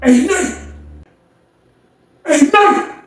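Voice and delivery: high call along the cell tier; imitated high-pitched hail. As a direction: holler a name to someone far off. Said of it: "He was like".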